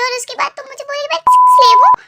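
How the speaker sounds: wife exploding at her husband; angry outburst. Acted. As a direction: throw the insult back at him in a rage. Wife's outburst in quarrel.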